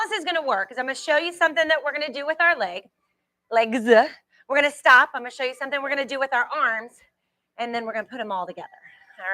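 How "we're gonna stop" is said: firm loud stop call. Real, not acted. Instructor halting class, loud.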